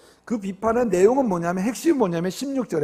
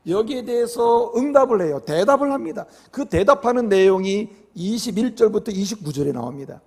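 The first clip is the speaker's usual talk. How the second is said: ordinary talk, nothing marked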